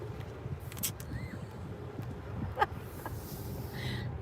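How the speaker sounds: squealing breathy giggle; high laugh. Real, not acted. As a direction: giggle high after the prank. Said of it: "Oh my God, that was so much fun".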